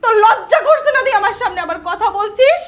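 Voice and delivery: loud, explosive angry rebuke; furious shouting. Acted. Sister silencing her apologising brother, furious shouting. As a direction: shout him down with real force.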